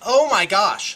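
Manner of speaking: loud, high gasp of disbelief; shocked exclamation. Real, not acted. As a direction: blurt it out in shock. Three sixes rolled against him.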